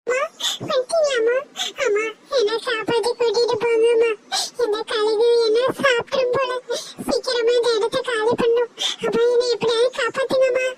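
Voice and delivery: high, crying plea to mother; a rescued child begging to be taken away; high-pitched tearful child voice; tearful little girl. Acted.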